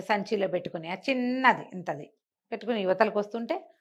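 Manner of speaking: usual speaking voice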